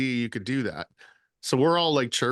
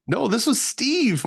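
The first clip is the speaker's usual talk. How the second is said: drawn out and much higher; slow, high insistence; emphatic correction; 11 semitones higher, 4 dB louder, slower than usual. Real, not acted. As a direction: stretch it out in excited disbelief.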